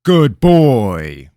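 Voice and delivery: loud, high mocking praise; king mocking the kneeling boy, malicious. A narrator's reading. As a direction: praise him like a dog. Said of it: "the king smiled maliciously".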